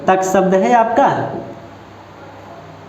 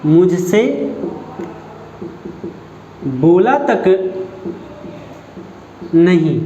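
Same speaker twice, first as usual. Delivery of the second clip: sulky complaint; 4 semitones lower, slower than usual. Shown as a demonstration. Teacher acting an offended friend, slow and hurt. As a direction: lower and drawn out, बोला तक नहीं dragged in hurt pauses. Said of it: "और वो नाराज़ है".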